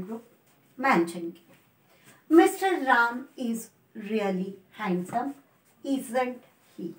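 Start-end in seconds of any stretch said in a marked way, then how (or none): 2.4-6.9 very slow, word by word; slow example read. Shown as a demonstration. Teacher reading the example sentence slowly to students. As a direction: lay out the sentence slowly for the class. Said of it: "falling intonation को use करना है".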